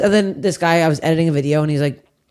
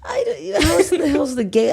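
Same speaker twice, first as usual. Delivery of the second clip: very high, squeaky echo of the suggestion; mock-whiny protest; 10 semitones higher than usual. Real, not acted. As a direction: throw it back high-pitched, half laughing. Echoes the other's suggestion in protest.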